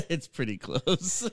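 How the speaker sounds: laughing through the words. Real, not acted. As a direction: chuckle while saying it. Laughing while echoing the quote.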